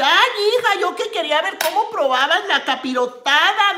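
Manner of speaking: lightly voiced scolding mother; imitating her mother. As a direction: play mom complaining about the missed video.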